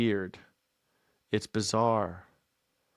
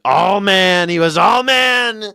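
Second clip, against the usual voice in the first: loud, higher gushing praise; mock gushing; 6 semitones higher, 5 dB louder, slower than usual. Streamer mocking Trump's catchphrase, loud mock gush. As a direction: belt out the catchphrase like a fan.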